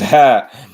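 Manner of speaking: short loud laugh; burst of laughter. Real, not acted. Laughs at rematch idea.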